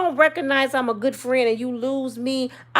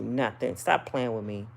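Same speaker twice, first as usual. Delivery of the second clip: low, much softer sulky reply; quiet when mad; 11 semitones lower, 9 dB quieter than usual. Retold or imitated. To her man asking what's wrong, quiet sulky reply. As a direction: clam up and answer under her breath. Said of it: "I get quiet".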